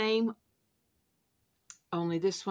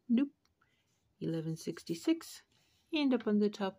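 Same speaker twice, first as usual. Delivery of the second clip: normal talking voice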